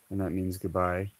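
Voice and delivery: ordinary talk, nothing marked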